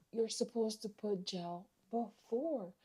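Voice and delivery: very soft and slow; quiet retold correction. Customer correcting the stylist about gel, hushed and slow. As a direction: drop the volume right down, spacing out the words.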